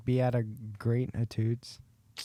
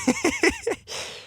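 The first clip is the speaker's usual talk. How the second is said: high squealing laugh. Real, not acted. Reacts to the fart.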